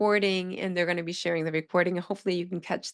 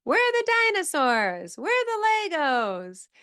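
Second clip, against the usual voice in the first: put-on young child asking; 9 semitones higher than usual. Teacher imitating a child asking for toys, high and sing-song. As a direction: lightly play a curious little kid. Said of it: "they say".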